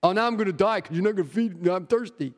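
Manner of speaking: lightly mimicked tantrum, quick and whining; mock a sulking kid who wants his drink; whiny child. Pastor mocking thirsty Samson to congregation, a hint of a whining child. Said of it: "like a little child throwing a temper tantrum".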